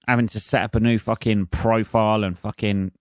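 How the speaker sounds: ordinary talk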